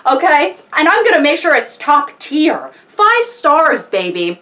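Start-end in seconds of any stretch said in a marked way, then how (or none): none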